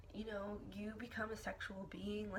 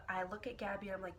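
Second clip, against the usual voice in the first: ordinary talk, nothing marked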